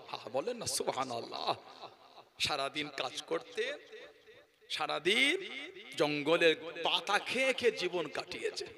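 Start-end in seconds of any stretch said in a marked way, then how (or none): none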